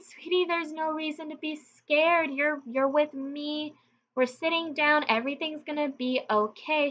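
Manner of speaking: calm her down gently; reassuring. Retold or imitated. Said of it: "I said".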